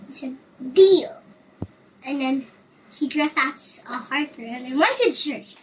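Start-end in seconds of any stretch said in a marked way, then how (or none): 0.7-1.2 loud, high, quick agreement; eager deal. Acted. Robin seals the bargain with the sad man, loud eager reply. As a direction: snap the word out with eager gusto.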